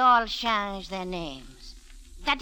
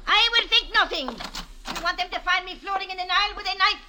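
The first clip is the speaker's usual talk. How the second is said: loud, high and fast, panicked refusal; frightened outburst; 7 semitones higher, 4 dB louder, faster than usual. Acted. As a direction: blurts it out in fear of being killed. Frightened woman refuses, shouting.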